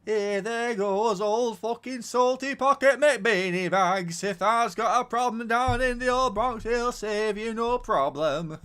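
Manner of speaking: put-on loud, high old northern woman; Nora Batty. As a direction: shout it like a nagging old dame. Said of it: "Let's do a Nora Batty".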